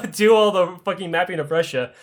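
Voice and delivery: normal talking voice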